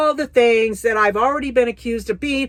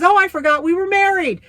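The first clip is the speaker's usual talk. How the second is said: careless husband; 8 semitones higher than usual. Retold or imitated. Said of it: "he says".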